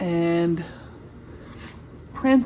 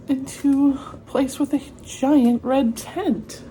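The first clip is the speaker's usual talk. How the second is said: ordinary delivery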